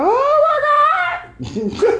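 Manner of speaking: very high, drawn-out mock wail; hammed-up overcome cry, stretched way out; retold tearful outburst. Re-enacting watching a dad hold his son, high stretched wail. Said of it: "he was about to cry. And then I was like".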